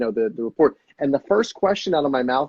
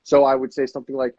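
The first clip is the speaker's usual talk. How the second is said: ordinary talk, nothing marked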